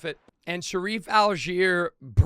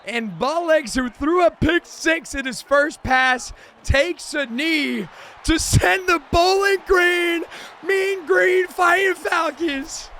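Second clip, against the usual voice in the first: high, loud, slowed play-by-play; slow, drawn-out big-moment call; hyped announcer call; 9 semitones higher, 6 dB louder than usual. Acted. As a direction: call the title clincher like a broadcaster.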